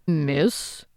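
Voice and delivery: low raspy impatient "Miss?"; lightly voiced gruff lord prompting; deep impatient rumble. A narrator's reading. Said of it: "deep, raspy, and impatient".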